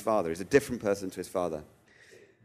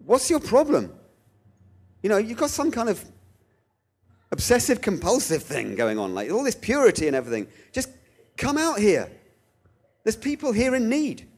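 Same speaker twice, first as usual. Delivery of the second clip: exasperated challenge; 10 semitones higher than usual. Shown as a demonstration. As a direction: scold God like a frustrated friend. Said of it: "a different intonation which might be helpful".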